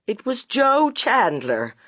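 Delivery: ordinary delivery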